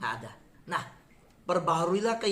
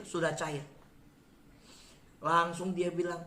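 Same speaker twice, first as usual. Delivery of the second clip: normal talking voice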